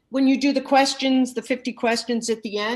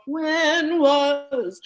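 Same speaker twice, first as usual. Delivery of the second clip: crying gili; 5 semitones higher than usual. Shown as a demonstration. Coach showing the cry to avoid, with a sob. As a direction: voice catching in a sob. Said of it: "I wouldn't do the gili".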